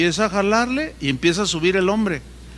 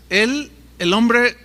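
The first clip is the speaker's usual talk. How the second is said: ordinary talk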